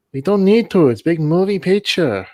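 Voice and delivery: high, loud, badly faked foreign accent; hammed-up film director's accent, badly done; bad Tommy Wiseau accent. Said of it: "That was a really shit accent".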